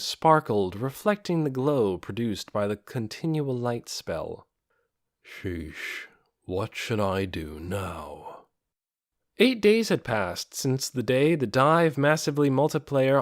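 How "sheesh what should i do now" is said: low, soft, slow undead lord muttering; a weary skeleton king thinking aloud, lower and softer; muttering to himself. A narrator's reading. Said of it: "responded to Ainz's muttering".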